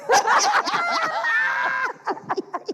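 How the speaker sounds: high cackling burst of laughter; shrieking laugh. Acted. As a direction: let out a wild shrieking laugh. Big laugh at toilet story.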